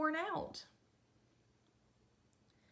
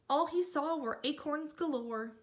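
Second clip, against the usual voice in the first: plain reading voice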